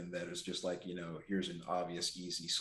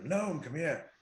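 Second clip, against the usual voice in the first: raised, high in-character holler; yelling out; 9 semitones higher, 5 dB louder, slower than usual. Acted. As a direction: call out high and loud. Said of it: "I yell out there".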